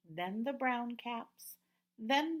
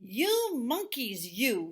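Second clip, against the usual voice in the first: angry peddler; 3 semitones higher, 5 dB louder, slower than usual. Acted. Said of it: "This made the peddler angry".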